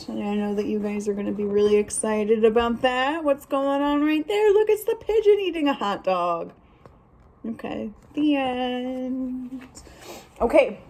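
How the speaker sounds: ordinary delivery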